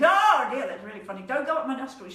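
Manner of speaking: loud, high ticklish protest; trying not to laugh. Acted. Moose with spider near his nostrils, trying not to laugh. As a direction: blurt it out as if being tickled. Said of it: "Moose is trying not to laugh".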